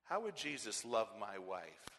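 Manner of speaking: ordinary talk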